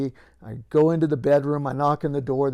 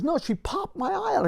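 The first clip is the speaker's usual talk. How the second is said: high-pitched protesting victim; insistent retelling; 14 semitones higher than usual. Retold or imitated. Injured man insisting to the disbelieving officer, high-pitched. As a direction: imitate him insisting, voice jumping up high.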